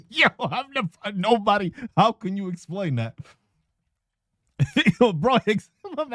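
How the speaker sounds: high, soft, laughing; can barely get it out for laughing; giggling, words breaking up. Real, not acted. Cracking up mid-rant.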